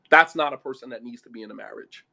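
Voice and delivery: low-pitched, firmer verdict; firm restatement. Real, not acted. Preacher saying who shouldn't marry, firm and low. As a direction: lay down the verdict firmly. Repeats verdict after "this real talk.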